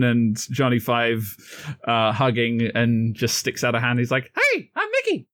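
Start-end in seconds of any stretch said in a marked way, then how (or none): 4.3-5.3 very high squeaky mouse voice; high cartoon mouse introducing himself; Mickey voice.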